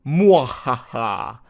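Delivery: whispered evil cackle, slow and drawn out; evil laugh. Retold or imitated. Reading a forum boast aloud, hissed evil laugh. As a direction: breathe out a sly villain laugh. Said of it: "Evil emoji".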